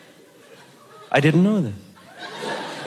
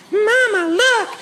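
put-on high-pitched child calling to Mama; mock kid's high holler; excited little kid; 13 semitones higher, slower than usual. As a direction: squeal it like a little kid, stretching the last words. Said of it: "Little kids are going".

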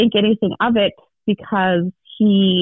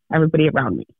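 ordinary talk

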